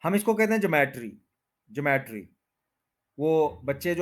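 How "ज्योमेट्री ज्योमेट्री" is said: ज्योमेट्री as juh-MET-ree, three syllables; common mispronunciation. Shown as a demonstration. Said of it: "बहुत ज्यादा गलत बोला जाता है".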